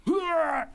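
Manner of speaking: high straining shout like a gym lifter; strained lifting yell. Real, not acted. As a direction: yell out the effort of the lift. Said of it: "Sounding like, uh, Badlands Chugs".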